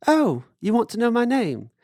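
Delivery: high put-on voice; imitated realization. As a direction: act out finally catching the question. Boatman finally understands.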